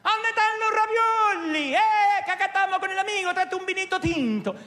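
put-on high, loud cheerful Italian accent; joyful Italian accent, shouting to the family; Italian at family table. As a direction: call out happily for ravioli and wine. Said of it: "Ese es el italiano".

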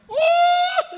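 very high, loud mock yelp; a hammed-up cocky yelp; boastful whoop. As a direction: whoop like you know him well. Stranger boasts he knows preacher.

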